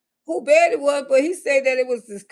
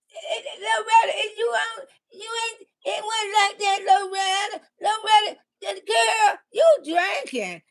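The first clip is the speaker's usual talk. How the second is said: put-on pleading boyfriend; 6 semitones higher than usual. Boyfriend voiced in story.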